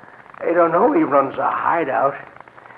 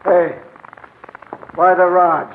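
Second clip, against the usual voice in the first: guarded voice through the door; suspicious guard. Acted. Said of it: "knocks quickly and softly".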